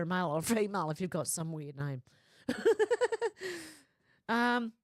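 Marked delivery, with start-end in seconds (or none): 2.5-3.7 sudden high-pitched burst of laughter; real laugh, pitched way up. Real, not acted. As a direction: break into a squeaky laugh. Amused by her own remark.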